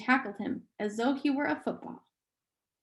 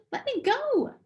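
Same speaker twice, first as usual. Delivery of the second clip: high, sharp demand; sharply; 12 semitones higher than usual. Acted. A boy ordering his sister to release him, high and sharp. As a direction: pull away and bark it, much higher. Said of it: "Charles said sharply".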